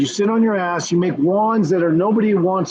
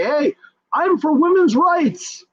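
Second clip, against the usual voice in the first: mocking quote; 9 semitones higher, slower than usual. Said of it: "on YouTube to say".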